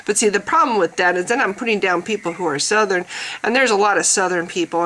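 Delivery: ordinary talk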